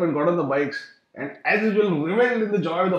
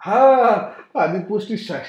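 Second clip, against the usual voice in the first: ordinary delivery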